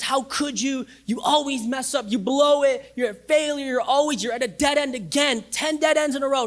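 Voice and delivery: high, accusing scold; an imagined harsh God scolding, pitched high; mock condemning scold. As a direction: berate them like a harsh judge. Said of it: "No, God goes".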